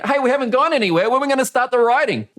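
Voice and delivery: imagined student eager to start riding; impatient learner. Retold or imitated. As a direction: complain it's taking forever.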